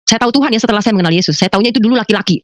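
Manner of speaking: ordinary talk, nothing marked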